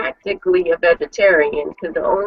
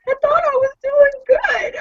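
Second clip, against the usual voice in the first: much higher, laughing whine; mock hurt husband. Husband defending his salad choice, laughing mock whine. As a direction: play the wounded husband for laughs.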